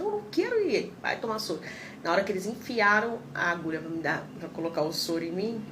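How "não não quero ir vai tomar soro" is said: high pitched mock whine of refusal; retelling her own panicked no, high and whiny; childish refusal. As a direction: squeak it out like a scared kid refusing.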